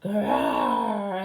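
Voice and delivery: loud, low, long growl; tiger growl. A narrator's reading. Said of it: "horrible noise that sounded like".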